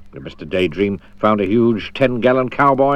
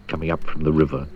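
ordinary delivery

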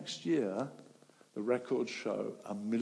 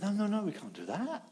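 higher alarmed refusal; mock protest; 9 semitones higher than usual. Sailors refusing to throw Jonah over, high and anxious. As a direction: push back in alarm.

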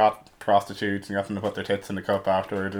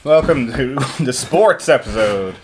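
announcer voice; 6 semitones higher, 6 dB louder, slower than usual. Acted. Podcast host intro, loud and high.

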